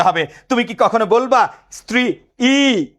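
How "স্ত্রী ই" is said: স্ত্রী, then a long detached ই; separate vowel. Shown as a demonstration. Teacher mocking a wrong reading, স্ত্রী ই with ই said apart. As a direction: break স্ত্রী ই into "stri... ee". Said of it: "ই কি আলাদা উচ্চারণ করবা".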